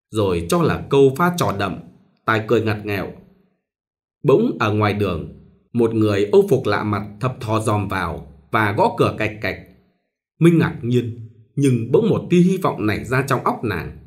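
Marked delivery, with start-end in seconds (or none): none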